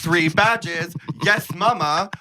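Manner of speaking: high, sing-out hype voice; mock sassy. Mocking queens performing at the morning table, high forced sass. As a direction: parody over-keen cheering, pitched high. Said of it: "everyone's trying to be like".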